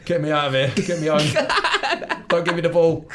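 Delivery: high, pleading cries to be taken off; mock desperate plea. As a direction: beg like a tired player wanting off.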